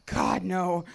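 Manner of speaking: loud, appalled refusal; disgusted. Acted. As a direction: recoil at the very idea. Asked if she tried the food.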